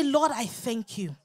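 usual speaking voice

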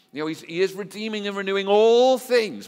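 soaring high and loud, preaching hard; full-force preaching. Real, not acted. Preacher urging the congregation, high and loud. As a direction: lift it up and drive it home to the church.

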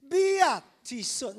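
hammed-up Italian accent, loud and high. Shown as a demonstration. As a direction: belt out a slow, stretched Italian phrase in accent. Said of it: "need to get more Italian than that".

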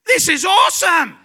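high, loud retold whoop of delight; exhilarated shout. Rider hanging upside down on the ride, thrilled yelling. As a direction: holler it with total glee.

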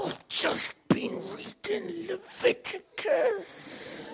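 slow, high, hushed mock-solemn German-free voice of a too-intense believer. Preacher mocking an intense Bible-college student, slow hushed mock solemnity. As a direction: drag it out slowly, very high and hushed, like a wide-eyed zealot.

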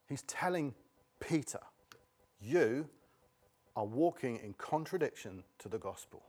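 softly, pausing after "telling", "Peter" and "You"; pauses for weight. Shown as a demonstration. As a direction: let "Peter" and "You" each stand alone between pauses. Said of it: "hold on for just one second and pause".

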